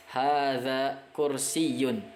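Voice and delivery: Hadza masjidun drawn out slowly and clearly; teacher modelling Arabic slowly; slow model reading. Shown as a demonstration. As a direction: read it slowly for students to repeat. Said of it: "model Arabic readings slowly".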